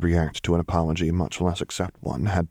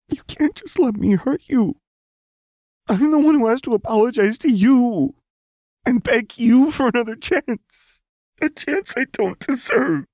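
very high, loud tearful plea; anguished; 5 dB louder than usual. A narrator's reading. Lux begging Jinx for another chance, anguished. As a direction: wail out the apology.

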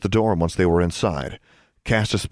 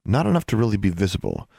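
ordinary delivery

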